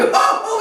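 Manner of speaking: mock boyish cackle; high giggling laughter. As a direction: laugh at him like naughty boys. Boys laughing.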